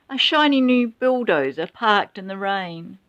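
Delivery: plain reading voice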